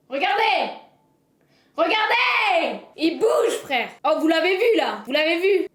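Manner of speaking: loud, high calls to the viewers; urgent shouting. Real, not acted. Streamer shouts to chat to look at the worm.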